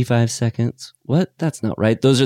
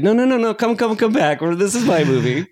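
much higher, pleading; pleading mock wife; 11 semitones higher than usual. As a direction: plead to come back. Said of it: "she's like".

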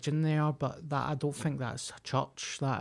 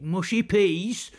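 louder, higher, drawn-out mimicry; mock royalist crowd; 6 semitones higher, 5 dB louder, slower than usual. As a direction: imitate a daft cheering onlooker.

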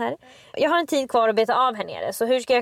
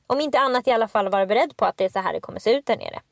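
usual speaking voice

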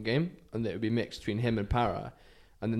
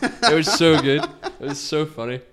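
laughing; 4 semitones higher, 7 dB louder than usual. Real, not acted. Laughing at the end of the story.

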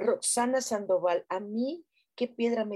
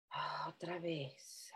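soft whispered cue; 5 semitones lower, 15 dB quieter, slower than usual. Acted. Whispering to her viewers mid-exercise to go again. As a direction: whisper it so the exercise isn't broken.

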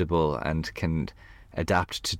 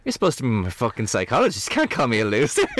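retold outraged What, much higher and louder; mock angry outburst; 7 semitones higher, 4 dB louder than usual. Telling off her psychologist, retold higher louder outrage. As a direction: snap back at him in indignant outrage. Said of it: "And I got really angry".

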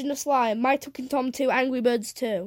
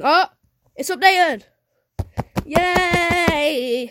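thrilled high cheer; excited; 6 semitones higher, 7 dB louder, much slower than usual. Real, not acted. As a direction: celebrate the update, loud and high. Game finally updated.

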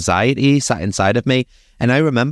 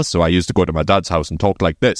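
forced low voice, pitched down; forcing a deep voice; 4 semitones lower than usual. Shown as a demonstration. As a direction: push the voice low to hide who you are. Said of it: "putting on a deep voice".

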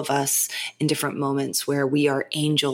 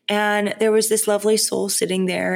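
ordinary talk

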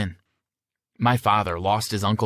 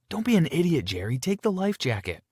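stern, higher scolding voice; sternly; 8 semitones higher than usual. A narrator's reading. Sister scolding her brother to wear a jacket, stern. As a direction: scold your brother firmly. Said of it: "Emma said sternly".

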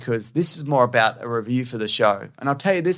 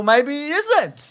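teasing; 3 dB louder, much slower than usual. Pretend. Teasing speculation about friend.

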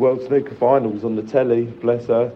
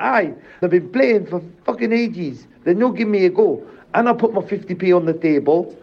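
mum's grumble, higher, nearly laughing; imitating mum; 7 semitones higher than usual. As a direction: do mum complaining about the players, pitched up. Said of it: "And then she was like".

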